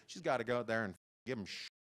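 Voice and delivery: normal talking voice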